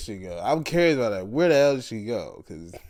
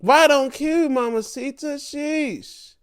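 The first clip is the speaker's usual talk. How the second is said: high-pitched fangirl gush; thrilled reaction; slower than usual. Retold or imitated. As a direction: gush at the TV as she shows up. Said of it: "I'm like".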